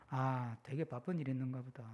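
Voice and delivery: low, soft, understanding murmur; calm forbearance. Acted. Driver excusing a rude driver, calm and soft. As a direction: let it go quietly, giving the benefit of doubt. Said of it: "지금은 조금 성숙해져가지고".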